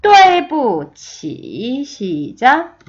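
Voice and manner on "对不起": 对不起 very slow, sharp fall then a dip and rise; tone demonstration. Shown as a demonstration. Teacher modelling the whole phrase, 对不起 slow and toned. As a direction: hit 对, drop, then stretch 起 through its dip. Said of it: "저랑 같이 세 번 읽어볼게요".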